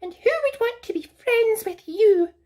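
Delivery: very high, prim bird voice; Sylvia the bird. Acted. Haughty bird correcting Rapunzel, very high voice. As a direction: squeak it out like a haughty little bird. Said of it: "she replied".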